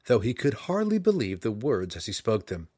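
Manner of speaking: usual speaking voice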